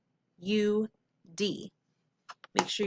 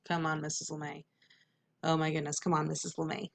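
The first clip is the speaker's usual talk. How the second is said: impatient student; 4 semitones lower, 7 dB quieter, faster than usual. Acted. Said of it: "go too slow where you're like".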